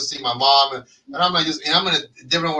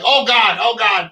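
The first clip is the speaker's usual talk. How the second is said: dismayed groan; 5 semitones higher, 6 dB louder than usual. Retold or imitated. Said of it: "No, I'm like".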